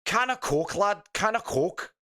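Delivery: high-pitched mock Scouse; amateur Scouse accent, way up high; attempted Scouse accent. Shown as a demonstration. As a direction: put on an exaggerated Liverpool Scouse, pitched high. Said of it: "a Scouse accent".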